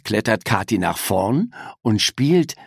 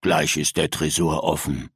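tiefe, leisere Gaunerstimme über Funk; über Walkie-Talkie; 7 semitones lower, 3 dB quieter than usual. Acted. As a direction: raunender Komplize am Funkgerät. Gauner antwortet über Funkgerät. (tiefe Gaunerstimme über Funk)

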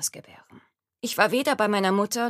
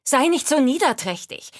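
firm rebuke; 6 semitones higher, 6 dB louder than usual. Acted. Healer firmly rebukes the laboring queen.

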